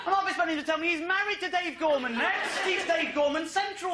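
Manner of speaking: very high, exasperated comic rant; outraged disbelief. Real, not acted. Comic to audience on family name, outraged disbelief.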